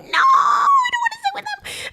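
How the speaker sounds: high cracking sob-shout; scared waitress begging her boss, crying out; panicked crying plea. Retold or imitated.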